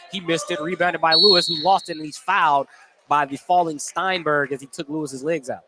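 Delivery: ordinary talk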